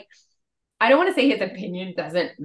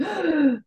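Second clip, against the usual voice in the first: high, sharp intake of breath; cringing gasp; 8 semitones higher than usual. Acted. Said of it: "and cringing as he's saying it".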